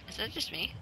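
soft unvoiced whisper. Real, not acted. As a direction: breathe it out under your breath. Quiet aside while gaming.

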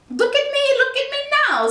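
high, showy cat voice; a boastful cat showing off its tricks; the Cat in the Hat. A narrator's reading. The cat boasts to the children, high and showy. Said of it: "said the cat".

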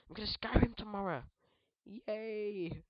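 high, loud gleeful cheer; excited cheer. Real, not acted.

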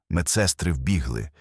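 ordinary delivery

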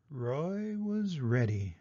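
slow, soft, ominous narration; hushed suspense. A narrator's reading. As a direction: draw it out quietly for the big reveal. Ominous story climax.

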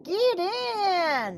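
high, drawn-out call; urgent invitation. Acted. Birdie offers frightened fish a hiding place, high drawn-out call. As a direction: usher them in, high-voiced.

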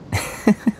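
short airy laugh; a brief knowing laugh; light chuckle. Acted. Amused at their secrecy.

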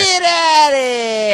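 very high, loud, stretched-out holler; drawn-out heckling shout. Retold or imitated. Man in bleachers chasing off the mascot, drawn-out shout. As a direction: scream it slowly across the bleachers. Cohost joins the heckler's yell.

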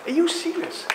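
high, slow, stunned disbelief; alien disbelief. Retold or imitated. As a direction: gasp at it like a baffled visitor from another planet. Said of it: "Imagine someone coming from another planet".

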